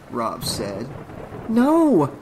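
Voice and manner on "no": high, loud cry of refusal; protest. A narrator's reading. Trish refuses to stay behind while Rob goes down, loud protest. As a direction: cry out in objection. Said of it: "Trish protested".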